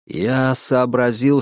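ordinary delivery

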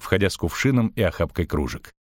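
plain reading voice

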